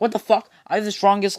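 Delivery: high, loud indignant outburst; acted incredulous rant; outraged disbelief. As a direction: protest in shock, voice shooting up. Madara outraged in story.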